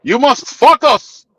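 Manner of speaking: put-on heavy Greek accent, focus sounding like "fuck us". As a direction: imitate a serious Greek coworker's heavy accent. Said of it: "he would say it like this".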